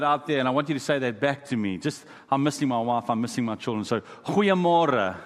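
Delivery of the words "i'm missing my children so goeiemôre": usual speaking voice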